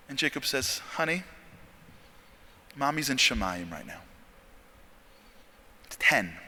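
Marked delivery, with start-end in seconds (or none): 0.9-4.0 softer voice breaking bad news; a hint of a tender dad; gentle father. Father tells son mother died.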